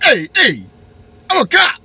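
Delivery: very high, loud hammed-up cop; a squealing mock cop yelling at the driver. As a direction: shout like a self-important cop. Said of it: "Flips his lights at me like".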